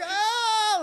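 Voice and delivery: high startled scream; shocked scream. Retold or imitated. Teens hit by splattering blood, high startled scream. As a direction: yelp it in horror. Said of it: "like that".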